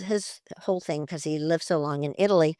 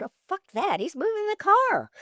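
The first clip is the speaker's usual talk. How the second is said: alarmed outburst; 12 semitones higher than usual. Retold or imitated. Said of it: "I said".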